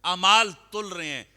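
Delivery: loud sermon cry on the weighing of deeds; preaching at full force. Acted. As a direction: ring it out to the hall. Dramatic sermon peak.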